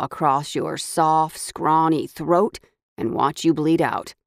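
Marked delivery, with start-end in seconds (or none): none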